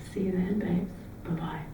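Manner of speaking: soft whispered bye to a lover; whispered goodbye. Acted. Ending a fake call to a lover, a whisper. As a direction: breathe the goodbye into the phone.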